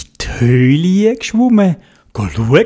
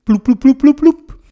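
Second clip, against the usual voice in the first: bubbling sounds; 9 semitones higher, 4 dB louder than usual. A narrator's reading. Bubbles rising underwater.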